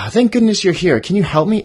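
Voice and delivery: high, loud relieved plea; lighter bunny plea. Shown as a demonstration. Bunny greets the badger, high relieved plea. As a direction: sound relieved to see him. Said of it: "It's softer".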